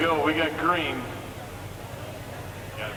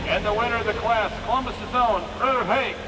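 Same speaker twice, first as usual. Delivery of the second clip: tractor pull announcer; 7 semitones higher than usual. Real, not acted. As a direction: hype the winner to the crowd. Announcing the class winner.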